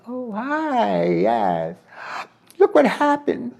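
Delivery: high put-on voice of the host; imitating the host. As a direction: greet her, then complain in a high voice. Host's voice in dialogue.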